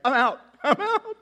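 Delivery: comic panic, backing away laughing; high squeaky scared bail-out; laughing retreat. Retold or imitated. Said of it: "I'd be like".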